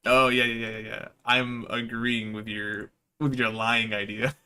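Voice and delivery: ordinary talk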